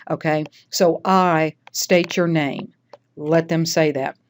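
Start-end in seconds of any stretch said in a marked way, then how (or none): 1.0-2.7 "I", held, then a pause before "state your name"; slow "I", stop briefly, then "state your name"; pause after I. Shown as a demonstration. Teacher showing the comma in the oath, pause after "I". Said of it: "because of the comma".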